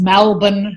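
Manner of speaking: Malbon, first vowel opened to a short 'a'; shifted Melbourne vowel. Shown as a demonstration. Linguist showing a vowel change, Malbon with an open 'a'. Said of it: "Melbourne might be pronounced as".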